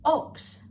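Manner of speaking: "Obst" said with P for B; high, isolated "Obst" with a clear P sound; B pronounced as P. Shown as a demonstration. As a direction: demonstrate "Obst" as "opst". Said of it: "the B in the word is pronounced as".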